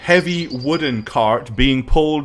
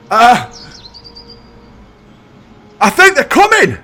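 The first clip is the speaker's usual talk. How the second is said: high, loud lookout's yell; yelled warning; 8 semitones higher, 7 dB louder than usual. Acted. Lookout yells down to those below, urgent high shout. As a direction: call the alarm down from the tree. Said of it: "Yells down".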